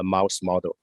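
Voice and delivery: normal talking voice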